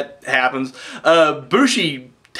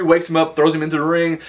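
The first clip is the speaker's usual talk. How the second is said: ordinary delivery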